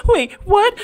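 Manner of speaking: shocked. Acted. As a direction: caught completely off guard. Shocked response in scene.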